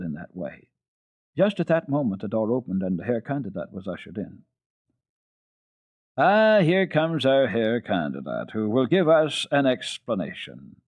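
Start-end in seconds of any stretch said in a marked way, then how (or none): 6.2-10.8 higher, louder, hearty welcome; exclaiming father. Acted. Father welcoming the tutor, higher and hearty. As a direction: greet the tutor gladly. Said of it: "exclaimed Herr Sesemann".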